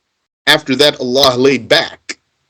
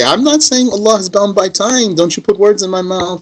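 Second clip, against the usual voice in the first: higher, fast mock protest; indignant opponent; 7 semitones higher than usual. Mimics the opponent denying the charge, higher, quick and indignant. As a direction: act out his huffy denial. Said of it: "He's gonna say".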